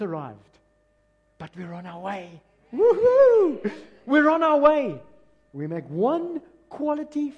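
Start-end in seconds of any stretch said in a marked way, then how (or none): 2.7-3.7 high, loud "woohoo" cheer; drawn-out high-pitched cheer; loud celebratory whoop. Real, not acted. Preacher cheers the congregation's progress. 4.0-5.0 high, loud, cheering shout; exuberant, raised-voice cheer; loud celebration. Real, not acted. Repeats line as a celebration.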